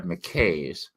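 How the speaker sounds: McKay's said with a long A, like "kays"; Americanized pronunciation. Shown as a demonstration. Explaining the Tennessee bookstore name, McKay's said the American way. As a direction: rhyme McKay's with "days". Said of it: "It's just Americanized".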